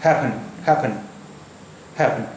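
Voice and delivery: हैपन with one p only, soft ending; single p sound. Shown as a demonstration. Teacher showing the double p sounds once. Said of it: "सिर्फ एक ही बार निकला".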